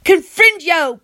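loud, high spell shout; yelled spell. A narrator's reading. Boy casting his best spell, yelling. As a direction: fling the spell at him. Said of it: "point it at Blodwin and yell".

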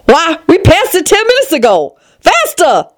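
high, impatient queenly cry; urgent Red Queen. Acted. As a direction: urge her on impatiently. Queen urging Alice on.

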